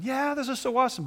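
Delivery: high, quick mock cheer; high-pitched, hurried imitation of rejoicing; imitated joy. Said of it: "Some people were joyous".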